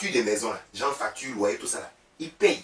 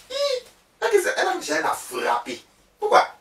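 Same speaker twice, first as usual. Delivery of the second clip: shrill outburst; 4 dB louder than usual. Real, not acted. Outburst high, cry heard.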